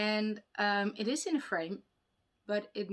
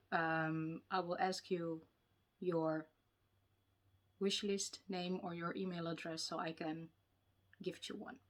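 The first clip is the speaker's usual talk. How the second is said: ordinary delivery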